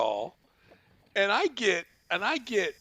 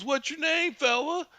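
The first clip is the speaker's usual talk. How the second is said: stoner impression; 12 semitones higher than usual. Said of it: "going back to the stoner".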